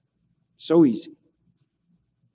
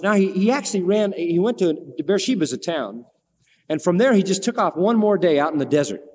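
ordinary talk

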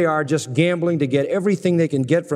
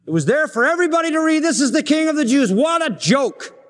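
mock proclamation; 10 semitones higher than usual. Mocking the sign on the cross.

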